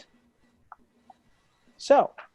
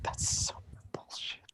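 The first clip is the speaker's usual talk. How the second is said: whispered grumble; 13 dB quieter than usual. Real, not acted. GM grumbling at the rules.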